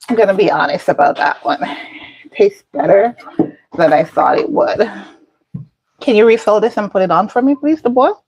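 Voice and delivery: usual speaking voice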